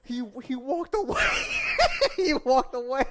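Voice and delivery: words squeezed out between laughs, high and loud; laughing so hard the words go high; laughing through words. Real, not acted. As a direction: talk while cracking up. Laughing while telling story.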